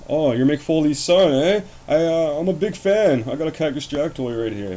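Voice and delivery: loud, high imitation of an eager fan; hammed-up starstruck guy, pitched high; gushing fan. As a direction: play an overexcited fanboy introducing himself. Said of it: "he sees this one guy".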